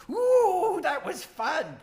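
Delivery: very high, gleeful troll squeal; high-pitched, overjoyed creature voice; excited troll. Acted. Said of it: "the troll gets really excited and jumps and goes".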